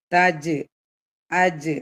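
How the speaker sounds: short തജ്, അജ് with an echoed j release; qalqalah bounce. Shown as a demonstration. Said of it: "കൽക്കലയാണ് അജ്".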